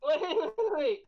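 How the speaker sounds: high, quick stacked waits; excited wait. Real, not acted. Host notices friend's odd else, high excited interruption. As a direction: jump in to catch it.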